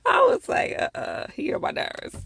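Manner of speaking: very high, squeaky laughing voice; laughing while talking. Real, not acted. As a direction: squeeze the words out through laughter. Still cracking up over the show.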